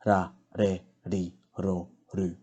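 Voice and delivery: "ra, re, ri, ro, ru" with each R a soft D-like tap; spaced syllable drill with soft tapped R. Shown as a demonstration. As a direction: tap the R softly like a D, one syllable at a time. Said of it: "Softer D sound".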